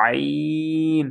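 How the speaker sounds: আইন pressed in the throat, drawn slow; throaty Ain. Shown as a demonstration. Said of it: "গলার ভিতরে চাপাইয়া টানতে হবে".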